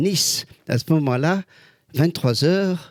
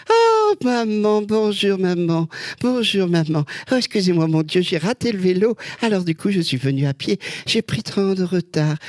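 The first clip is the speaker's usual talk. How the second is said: Madame Doubtfire; 5 semitones higher than usual. Retold or imitated. Lookalike greeting children at a table as the nanny, higher fussy voice. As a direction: play the flustered old nanny arriving late. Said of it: "Et Madame Doubtfire, qu'est-ce qu'elle a à nous dire?".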